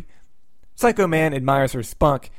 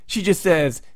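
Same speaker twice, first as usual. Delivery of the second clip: usual speaking voice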